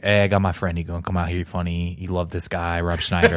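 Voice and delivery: low, soft, casual stage introduction; Adam Sandler. Retold or imitated. Comedian introducing his friend as opener, low mumbled impression. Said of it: "Adam Sandler was like".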